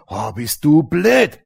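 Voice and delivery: slow, sneering inner voice; slow scornful inner voice; mock inner voice. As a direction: drag it out like self-scorn. Inner voice mocking self.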